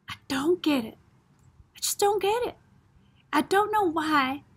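acted, baffled complaint; mild bafflement. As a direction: voice lifts in mild frustration. Puzzled Jem shaking his head.

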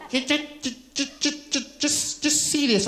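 mock trembling old-lady stammer; shaking old lady. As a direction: stammer rapidly on "ju" before getting it out. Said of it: "it was still shaking".

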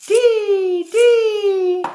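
high, loud sustained bird call; piercing held chirps; chickadee call. A narrator's reading. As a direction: sing out like a chickadee.

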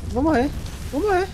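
high squealing panic; panicked. Real, not acted. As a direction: yelp as if about to die. Being chased by the boar.

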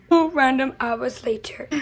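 whispered narrator time skip; whispered caption. Acted. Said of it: "time skip caption".

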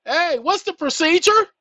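lightly voiced retelling of the question; quoting own request. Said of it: "My request was".

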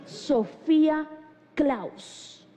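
very slow, reverent name reveal; drawn-out, grand announcement; glamorous awe. Shown as a demonstration. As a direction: savour the name like luxury. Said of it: "como que les sube algo, como que les luce".

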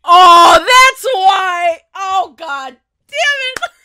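loud, drawn-out realization; loud slow exclamation. Real, not acted. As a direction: bellow it out slowly. Reacting loudly to reveal.

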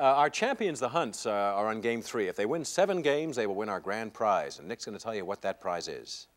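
normal talking voice

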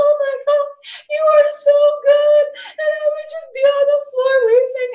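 high, loud, overcome with gratitude; tearful worship. Real, not acted. Worshipper telling God he is good, tearful and overcome. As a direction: voice soaring on the edge of weeping. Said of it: "I could do it right now".

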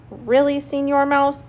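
mildly hurt, scolding protest; reproachful. A narrator's reading. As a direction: chide him as if wounded. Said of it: "said Señor Coyote reproachfully".

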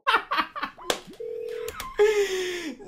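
long high laughing fit; can't stop laughing at the call; long laughter. Real, not acted. Streamer laughing at the angry man on the phone, long high laughter.

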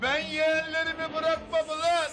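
put-on high, anguished drunk holler; impassioned drunken outburst; drunken defiance. Drunk uncle shouting about his nephews.

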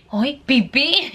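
lightly voiced toddler, drawn out; imitation de sa petite fille. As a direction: mimic a little child announcing it slowly. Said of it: "Y le hace".

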